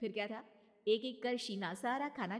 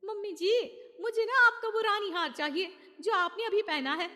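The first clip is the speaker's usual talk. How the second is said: sweetly coaxing bride; 11 semitones higher, 10 dB louder than usual. Acted. Daughter-in-law coaxing.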